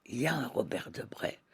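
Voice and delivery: unvoiced whispered musing; whispered aside. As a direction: breathe it as a private thought. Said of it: "inner thought retold".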